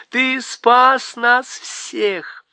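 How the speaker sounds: queen dancing round the room, elated; joyful queen. A narrator's reading. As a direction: spin with joy as you say it. Queen whirling faun joyfully.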